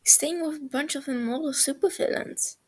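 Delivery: ordinary delivery, nothing marked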